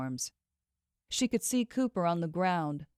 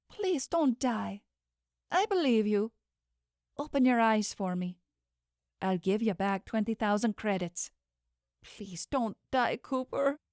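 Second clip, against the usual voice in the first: tearful pleading; 4 semitones higher than usual. Acted. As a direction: beg him to stay alive. Pleading with dying friend.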